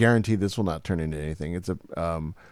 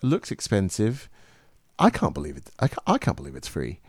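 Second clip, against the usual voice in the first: high-class British accent; 3 dB quieter than usual. Shown as a demonstration. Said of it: "high class".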